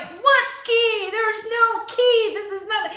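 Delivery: high, loud mock disbelief; imitating helpless exasperation on the phone; exasperated retort. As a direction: wail it as a hammed-up, frantic protest. Retold exasperated argument with husband.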